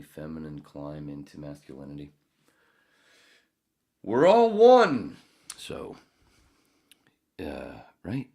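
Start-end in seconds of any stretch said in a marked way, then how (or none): 4.0-5.2 высокий, громкий и очень медленный пророческий возглас; провозгласи это как экстатичный гуру; mock mystic. Sudden mystical proclamation.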